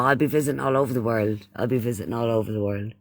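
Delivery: ordinary talk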